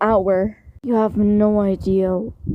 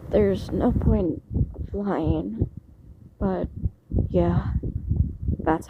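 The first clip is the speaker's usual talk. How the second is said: shaky voice; 2 semitones lower, 5 dB quieter, slower than usual. A narrator's reading. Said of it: "You spoke out in a shaky voice".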